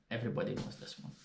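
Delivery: quick, whispered repeat; whispered aside. Shown as a demonstration. Teasing viewers about the next word, whispered. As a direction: mutter it softly to yourself.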